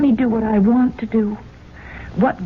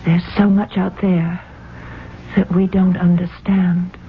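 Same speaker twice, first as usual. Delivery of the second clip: ordinary talk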